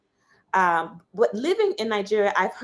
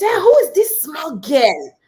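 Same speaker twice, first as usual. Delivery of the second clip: loud, high, slow bellow; retold shout; 6 semitones higher, 8 dB louder than usual. Big man in agbada yelling at the small girl, loud. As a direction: boom it out like a big man offended. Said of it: "he starts shouting".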